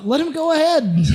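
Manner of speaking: high, quick half-yell; mock yell. Player yelling ahead to the party, high mock yell. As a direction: call it out high without real volume. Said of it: "I kinda yell that out".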